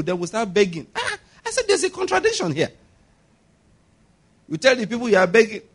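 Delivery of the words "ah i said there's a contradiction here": suddenly very high, squeaky objection; high-pitched protest. Retold or imitated. Preacher objecting to pastors who beg, high-pitched protest. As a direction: jump up high in mock protest.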